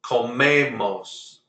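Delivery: comemos slowly, stressed on ME, co-ME-mos; second-to-last stress. Shown as a demonstration. Teacher modelling comemos for the class, slow, stressed on ME. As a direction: stretch the middle ME of comemos. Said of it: "second to last syllable".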